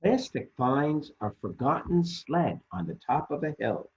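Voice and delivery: ordinary delivery, nothing marked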